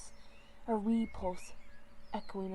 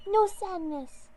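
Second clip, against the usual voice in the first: much higher, louder, slow squeak; squeaky kitten; 10 semitones higher, 8 dB louder, slower than usual. Acted. Ghost kitten squeaking to comfort the leader. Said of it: "the other one squeaked".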